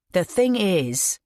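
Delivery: drawn-out, deliberate "The thing is"; spoken very slowly. A narrator's reading. Ian slowly stalling before Todd, deliberate. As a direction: stretch out "The thing is," weighing each word. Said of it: "Ian spoke very slowly".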